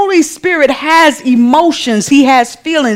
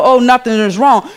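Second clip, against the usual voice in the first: ordinary talk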